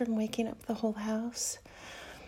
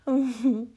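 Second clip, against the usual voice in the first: loud moan; 10 dB louder than usual. Acted. Moan in intimate scene.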